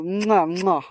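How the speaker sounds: kissing sounds. Acted. Kisses the liquor bottle.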